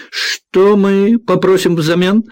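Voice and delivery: high, slow teasing Irish lilt; Irish accent. A narrator's reading. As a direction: tease him with a playful Irish lilt. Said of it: "ирландским акцентом". (игривый ирландский акцент)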